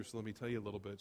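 ordinary talk

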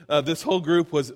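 normal talking voice

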